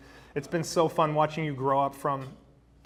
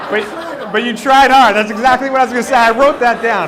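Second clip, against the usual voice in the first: louder, pushed over a laughing room; shouting over laughter; 7 semitones higher, 11 dB louder than usual. Real, not acted. Best man shouting over wedding guests' laughter, loud and amused. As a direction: raise your voice above the crowd.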